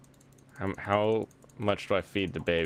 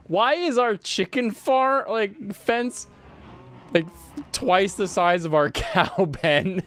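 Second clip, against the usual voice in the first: high, loud question broken by laughter; laughing disbelief; 12 semitones higher, 6 dB louder, slower than usual. Real, not acted. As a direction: laugh through a drawn-out, high-pitched question. Laughing at their lopsided animal pens.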